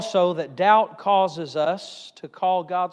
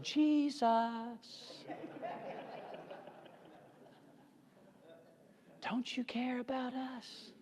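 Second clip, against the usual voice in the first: much softer, gentle asking, slow on the end; soft mock plea; 5 semitones higher, 14 dB quieter, much slower than usual. Shown as a demonstration. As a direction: say it softly, as they did not. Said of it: "they came to Him softly".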